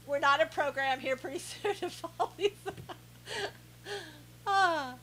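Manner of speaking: breaking up with laughter mid-sentence; helpless real laugh taking over the words. Real, not acted. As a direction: start talking, then dissolve into laughing. Cracks up at chat jokes.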